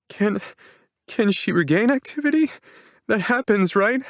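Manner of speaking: put-on high, pleading young woman's voice; cling to hope, stumbling; desperate hope. A narrator's reading. Begs doctor for hope.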